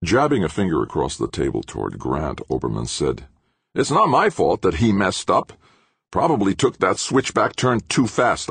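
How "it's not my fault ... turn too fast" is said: higher-pitched, defensive accusation; defensive blaming. A narrator's reading. Engineer blaming a colleague before the boss, defensive. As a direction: shift the blame onto someone else.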